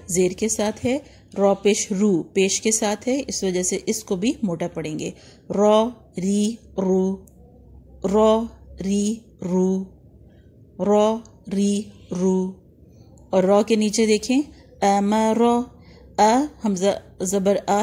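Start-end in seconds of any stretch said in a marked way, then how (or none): 5.5-12.6 "रॉ री रू" drawn out slowly, heavy "रॉ" and "रू", thin "री"; slow recitation drill, each syllable stretched. Shown as a demonstration. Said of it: "practice करेंगे".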